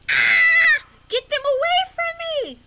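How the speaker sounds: high, loud frightened shriek; panicked scream. Acted. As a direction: scream to get bees away. Edgar panics at bees.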